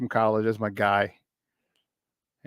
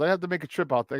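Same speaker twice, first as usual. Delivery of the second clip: ordinary talk, nothing marked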